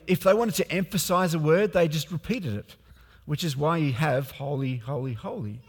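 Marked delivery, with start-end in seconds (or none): 4.3-5.6 soft, reverent Holy, holy, holy, falling away; hushed holy holy holy. Shown as a demonstration. Preacher hushes the angels' words to his congregation. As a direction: let Holy, holy, holy drop almost to a breath.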